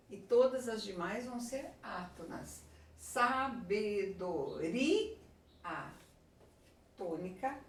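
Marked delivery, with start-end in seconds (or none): 3.0-5.2 sabedoria very slowly, syllable by syllable, rising to RI; stress on RI. Shown as a demonstration. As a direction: break up sabedoria and lift the RI.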